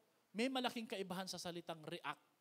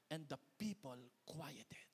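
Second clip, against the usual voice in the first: slow, hushed whisper; 5 semitones lower, 6 dB quieter, slower than usual. Acted. Pastor hushes the congregation, slow whisper. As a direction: let it drop to a whisper as the crowd goes still. Reading how the crowd fell silent.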